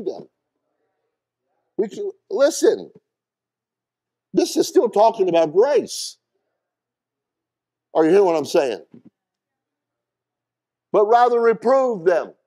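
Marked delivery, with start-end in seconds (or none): 1.8-12.3 high, loud, ringing preacher's cry; full-throated sermon peak; preaching at full force. Acted. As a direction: preach it out hard to the congregation.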